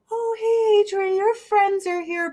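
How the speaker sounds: put-on high, sweet old grandma voice; a cheerful, oblivious granny welcoming guests. Grandma telling Adri her friends are here, high and sweet. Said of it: "my grandma comes out and she's like".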